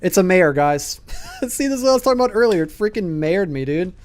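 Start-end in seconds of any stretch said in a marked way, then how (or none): 1.2-2.6 fast, high laughing rush. Real, not acted. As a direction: laugh through the words, excited. Ghost is a mare, laughs.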